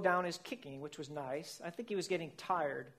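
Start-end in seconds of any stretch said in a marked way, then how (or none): none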